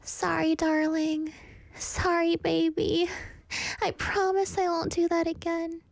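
put-on high, even, sing-song toxic girlfriend; Nikki. Toxic girlfriend promising her darling, high and eerily apologetic. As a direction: apologize in a high, held, sugary voice. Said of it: "I can be a great Nikki".

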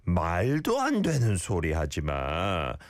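incredulous, with a big rise and fall; disbelief. Shown as a demonstration. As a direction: say it as if you can't believe them. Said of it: "There should be some disbelief in here".

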